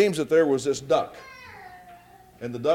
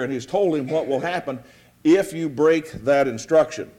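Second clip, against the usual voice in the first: ordinary delivery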